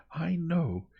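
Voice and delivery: "I know" pitched high and loud; strong emphasis. A narrator's reading. Young woman insisting on her knowledge, "I know" strongly emphasised. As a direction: hit "I know" hard, with certainty. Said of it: "She laid a strong emphasis on the words".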